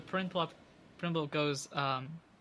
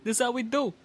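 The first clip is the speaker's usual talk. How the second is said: higher-pitched swaggering character voice; cocky champion; 8 semitones higher than usual. Acted. Prinplup answering an amazed fan, proud swaggering voice. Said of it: "from Boston with an Italian accent".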